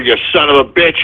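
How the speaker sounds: low-pitched, disgusted cursing; grumbled curse. Real, not acted. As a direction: curse the caller low in your voice. Host curses the caller, first take.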